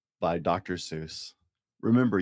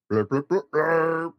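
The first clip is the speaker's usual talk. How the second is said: louder horn blurps; 7 semitones higher, 4 dB louder, slower than usual. Acted. Dad reading to a child, horn blurps. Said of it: "like a horn".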